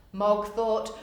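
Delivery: ordinary delivery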